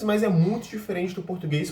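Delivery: normal talking voice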